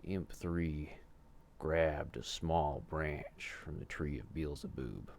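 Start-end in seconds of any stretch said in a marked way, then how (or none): none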